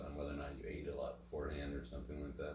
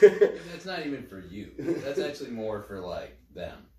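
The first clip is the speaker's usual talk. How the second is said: loud laughing protest; laughing loudly; 7 semitones higher, 13 dB louder, slower than usual. Real, not acted. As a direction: burst out laughing, louder. Laughing outburst.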